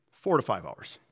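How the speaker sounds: ordinary talk, nothing marked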